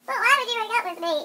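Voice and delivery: put-on high, loud hurt girlfriend voice; upset girlfriend. Girlfriend to boyfriend after a breakup, high loud upset voice.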